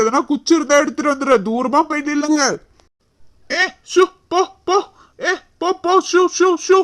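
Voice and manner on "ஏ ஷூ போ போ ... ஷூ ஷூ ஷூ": high, short shooing calls. Acted. Man tries to drive snakes away, shooing. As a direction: chase an animal off with shoos. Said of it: "ஷூ, போன்னு சொல்றீங்க".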